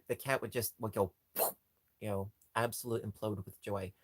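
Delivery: usual speaking voice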